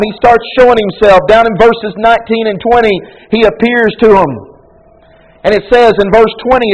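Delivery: high, loud, driving sermon voice; full-force preaching. Acted. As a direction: preach it hard to the congregation.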